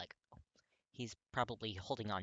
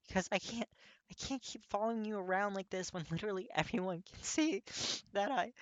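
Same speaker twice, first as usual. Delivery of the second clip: crying; 11 semitones higher, 6 dB louder than usual. Acted. Kirishima crying confession.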